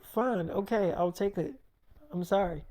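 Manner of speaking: soft, small, giving-in voice; meek quiet apology. Retold or imitated. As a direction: mumble it like a scolded partner.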